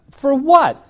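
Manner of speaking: shrill-pitched, loud "for what"; indignant surprise. Retold or imitated. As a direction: blurt it out in disbelief. Retold outrage at library fine.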